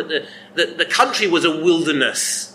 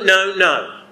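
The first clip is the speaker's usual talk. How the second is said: ordinary talk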